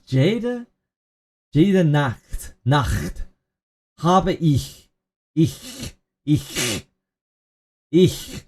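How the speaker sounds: normal talking voice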